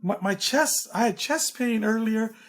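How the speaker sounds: high-pitched, baffled imitation of a performer; acted-out wonder at a sudden cure; amazed retelling.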